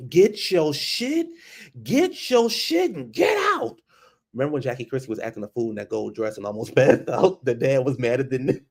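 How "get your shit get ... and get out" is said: high, slow mock yelling; hammed-up husband ordering her out; mock shout. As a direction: stretch it out like an outraged husband.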